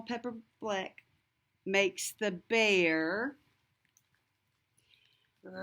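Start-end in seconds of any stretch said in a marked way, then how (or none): 5.4-5.6 sneeze. Acted.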